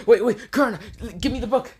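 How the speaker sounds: high-pitched, urgent panic; frantic pleading. Acted. Kakashi begs Kurenai for the book, frantic.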